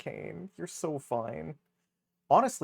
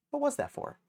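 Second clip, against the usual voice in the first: much higher, squeaky comic voice; 14 semitones higher than usual. Pretend. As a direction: squeak the question out. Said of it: "repeat in other voice".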